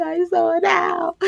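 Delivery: high, awed, wondering voice; dreamy delight. Retold or imitated. Lying in bed after seeing the owl, high awed murmur. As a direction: say it like a child in wonder. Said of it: "I really was like that".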